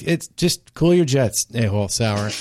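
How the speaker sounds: slow smug quip. Retold or imitated. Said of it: "And I'm like".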